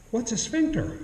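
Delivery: put-on a five-year-old asking innocently; little girl asking. Storyteller voicing five-year-old Anna's innocent question, high child voice.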